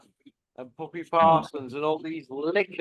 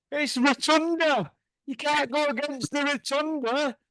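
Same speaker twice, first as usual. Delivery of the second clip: mock hype; 6 semitones higher than usual. Mocking Tony Bellew's hype.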